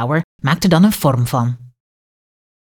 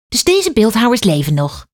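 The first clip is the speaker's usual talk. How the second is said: put-on higher child voice asking; girl asking eagerly, pitched up; curious little girl; 6 semitones higher than usual. A narrator's reading. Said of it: "vraagt Kaat".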